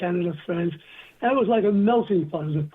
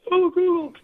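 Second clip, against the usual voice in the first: a squealing, much higher mock swoon; mock girlish gush, louder and higher; swooning girls; 10 semitones higher, 3 dB louder than usual. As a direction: imitate girls gushing over a cute accent, pitched way up. American girls swooning over accent.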